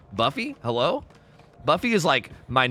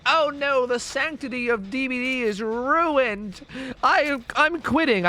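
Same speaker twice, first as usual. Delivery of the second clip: high, slow, hammed-up despair; mock outrage; 10 semitones higher, much slower than usual. Mocking the purist fans who would quit over Darth Vader, high slow hammed-up wail. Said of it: "I wouldn't be like".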